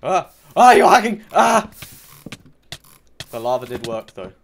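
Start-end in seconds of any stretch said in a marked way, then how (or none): 0.0-1.7 high, very loud outburst; accusing yell. Pretend. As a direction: yell it like you've been cheated. Killed by a supposed hacker.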